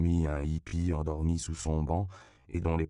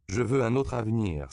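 put-on higher, louder plea from a boy; cri du cœur; 6 semitones higher, 5 dB louder than usual. A narrator's reading. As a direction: cry it out to the Virgin. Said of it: "s'écria Juan Diego". (s'écrier avec ferveur)

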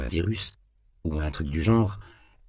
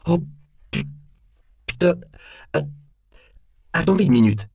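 broken, stuttering high and loud plea; flustered, stop-start stutter with pitch shooting up; panicked stammer; 10 semitones higher, 5 dB louder than usual. A narrator's reading. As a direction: stammer in alarm, breaking off words. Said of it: "bégaya Temple".

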